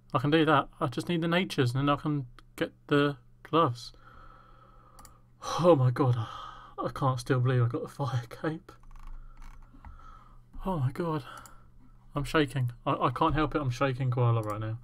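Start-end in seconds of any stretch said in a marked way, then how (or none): none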